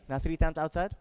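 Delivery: ordinary delivery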